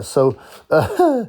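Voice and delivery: ordinary delivery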